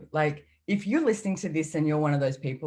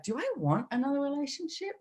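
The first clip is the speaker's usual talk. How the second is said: lifted, airy self-question; high musing inner voice; higher inner voice; 10 semitones higher, 4 dB quieter than usual. Retold or imitated. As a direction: ask yourself lightly, high.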